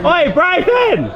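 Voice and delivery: calling out as the voice cracks high; a drawn-out shout that breaks upward. Real, not acted. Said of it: "I just had the biggest voice crack".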